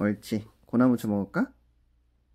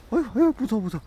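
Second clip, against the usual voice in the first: high whimpering voice for the dog; scared puppy voice; 9 semitones higher, much faster than usual. Pretend. Owner voices Budungi afraid of the stairs, high whimper. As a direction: speak the frightened puppy's thoughts.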